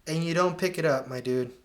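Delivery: usual speaking voice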